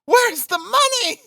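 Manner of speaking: very high, drawn-out shriek; frenzied cry. A narrator's reading. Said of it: "she cried".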